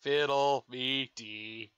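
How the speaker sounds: very slow, odd robot pirate voice. Retold or imitated. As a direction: drag out a silly pirate line. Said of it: "generic pirate phrases in a robot voice".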